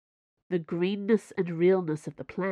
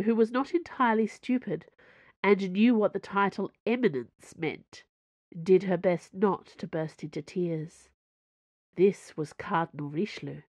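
ordinary delivery

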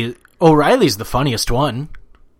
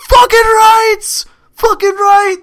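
very high, loud drawn-out victory shout; celebratory yell; 9 dB louder, much slower than usual. Retold or imitated. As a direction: yell it like you just won the Cup. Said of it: "you don't yell".

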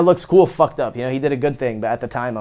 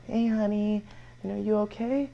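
soft mom voice; 8 semitones higher, 8 dB quieter than usual. Retold or imitated. Mother checking on him at night.

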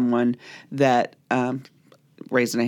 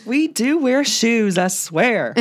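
mock Southern; 8 semitones higher, slower than usual. Joking about Kentucky.

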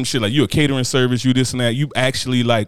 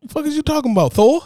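mock incredulous; 13 semitones higher than usual. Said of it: "Oh, Asgard?".

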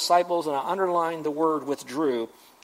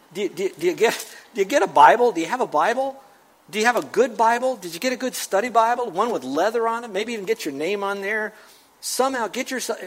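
preaching; 3 semitones higher, 3 dB louder than usual. Acted. Preacher urging the congregation.

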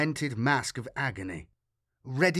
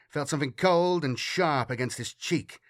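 plain reading voice

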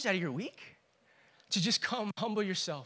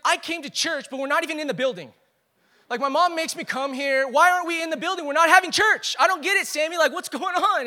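put-on higher, louder, whiny complaining kid; upset junior high kid; 8 semitones higher, 9 dB louder than usual. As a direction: voice the frustrated boy who wants real church. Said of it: "And he was so upset".

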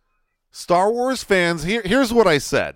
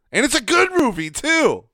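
emphatic; 9 semitones higher, 4 dB louder than usual. Real, not acted. Insisting the movie is good.

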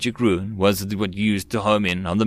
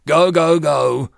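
loud, high urgent command; urgent charge order, yelled; hoarse call-out; 7 semitones higher, 6 dB louder, slower than usual. A narrator's reading. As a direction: shout the squad into action. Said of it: "called out hoarsely from his mask".

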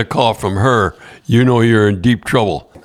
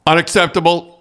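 mild mock outrage, pitched higher; imitating angry boss; 7 semitones higher than usual. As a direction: retell a stern, higher-pitched reprimand. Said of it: "boy, was he mad".

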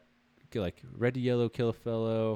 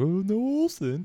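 high, slow, dragged-out disappointed "Oh, no, Olsen"; mock fan groan; 11 semitones higher, 4 dB louder, slower than usual. Fans groaning about Olsen, drawn out high and slow. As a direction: play a let-down fan.

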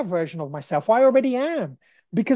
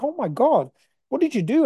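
higher-pitched astonished listener; mock amazement; 7 semitones higher than usual. People hearing his recovery story, amazed.

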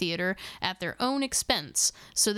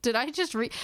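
usual speaking voice

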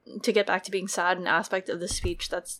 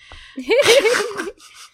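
loud laugh; 13 semitones higher, 9 dB louder than usual. Real, not acted. Burst of laughter at curse.

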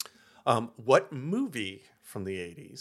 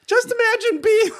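put-on high, loud Valley Girl squeal; 12 dB louder than usual. Valley Girl persona, shouted start.

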